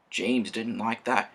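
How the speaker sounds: plain reading voice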